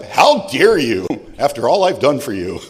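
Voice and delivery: loud, slow mock indignation; alcoholic bluster. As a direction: puff up with drunken outrage. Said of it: "got my alcoholic bluster up".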